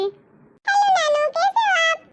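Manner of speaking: sweet little granddaughter voice; little girl voice. Acted. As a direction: pitch it up like a small girl greeting her nanu. Granddaughter greets her grandfather.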